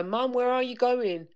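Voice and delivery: put-on a child calling out after her mum. Daughters calling after mum leaving the house, mock call.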